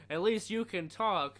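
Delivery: annoying CIA guy voice. Retold or imitated. CIA agent taunting masked man, slow and loud. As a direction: gloat it slowly. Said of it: "the C I A guy has the most annoying fucking voice ever".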